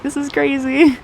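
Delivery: high, excited squeal of a quote, not shouted; excited kids. Retold or imitated. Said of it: "they're just so excited".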